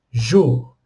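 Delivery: jour said alone, firm and falling; isolated stressed syllable. Shown as a demonstration. Teacher isolating the stressed syllable, firm falling jour. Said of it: "a última sílaba é a tônica".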